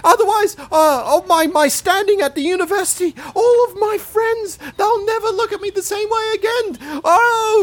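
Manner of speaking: panicked, high, near crying; frantic high pleading. Pretend. Begging secret stays hidden.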